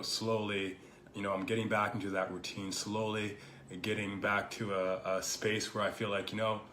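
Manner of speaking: normal talking voice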